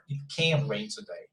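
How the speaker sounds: emphasize CAN, then let the rest drop; stress on CAN. Shown as a demonstration. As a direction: lean on the modal CAN. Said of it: "the stress here on".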